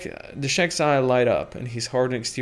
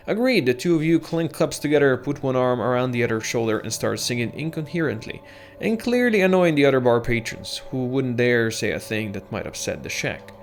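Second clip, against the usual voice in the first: ordinary talk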